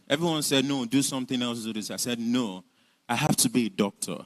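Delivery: ordinary delivery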